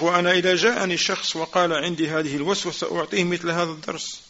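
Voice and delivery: quick, running recitation of the Arabic text; rapid text reading. Acted. Teacher reads the Shaykh's words to students, rapid recitation. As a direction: rattle through the quoted passage fast.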